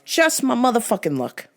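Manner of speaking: ordinary talk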